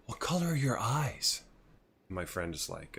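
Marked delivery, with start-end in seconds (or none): 0.1-1.4 higher, put-on voice of an admirer; imitating curious woman. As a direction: mimic her nosy interest, pitched up. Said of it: "She's like".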